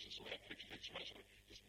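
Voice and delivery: ordinary talk, nothing marked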